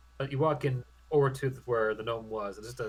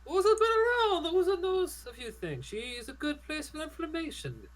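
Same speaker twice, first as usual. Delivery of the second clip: much higher gnome merchant voice; gnome shopkeeper voice. Acted. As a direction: play the old gnome trader.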